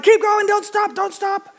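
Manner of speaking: high, excited mock call to keep pushing; urgent urging. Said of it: "And he's like".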